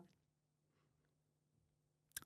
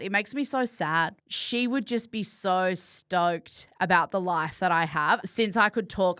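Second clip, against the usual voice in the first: ordinary talk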